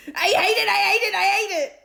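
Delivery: very high, loud whiny tantrum; dog tantrum. A narrator's reading. Dog throwing a tantrum at Stanley, whiny. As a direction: stamp your paws.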